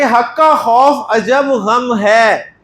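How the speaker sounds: slow, deliberate recital of the memory rhyme; drawn-out teacher recital; slow mnemonic recital. Shown as a demonstration. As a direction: spell out the rhyme slowly for students to memorise. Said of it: "तो result निकला कि".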